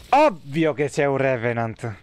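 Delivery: ordinary delivery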